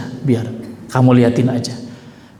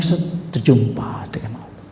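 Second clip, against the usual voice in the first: hushed, breathy whisper of awe; 5 semitones lower, 7 dB quieter than usual. Acted. Jibril whispering the Prophet onward to Allah. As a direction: breathe it out like a secret.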